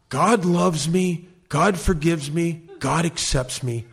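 slow, deliberate mirror affirmations; slow recital. Retold or imitated. Therapist's exercise, repeated slowly to the mirror. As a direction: recite each phrase slowly, like a chant. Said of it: "I want you to say o-, over and over again".

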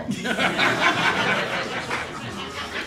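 breathy, unvoiced rising laugh; airy laugh. Real, not acted. Laughs at her own story.

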